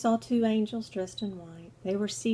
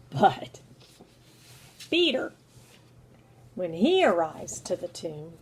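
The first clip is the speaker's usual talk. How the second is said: normal talking voice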